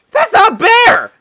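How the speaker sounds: high, loud cry of disbelief; shocked yell. Real, not acted. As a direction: shout that it is no bear. Shocked by a huge mob.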